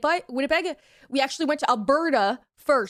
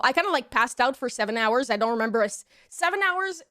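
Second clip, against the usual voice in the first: normal talking voice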